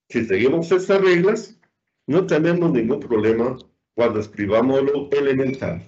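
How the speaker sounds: ordinary talk, nothing marked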